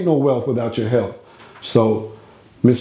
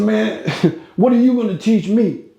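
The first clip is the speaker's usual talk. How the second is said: much higher mock old man with a laugh; scoffing Niccolo; 9 semitones higher than usual. Old man mocking Carlos's advice, laughing and higher. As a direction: laugh him off, amused old boss.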